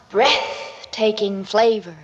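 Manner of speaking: ordinary delivery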